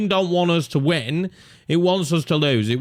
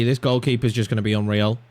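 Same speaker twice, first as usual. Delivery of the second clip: ordinary delivery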